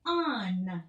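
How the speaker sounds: "On." said loud and clear for copying; modelled word. Shown as a demonstration. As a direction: model "On." crisply for a child. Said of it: "Here we go".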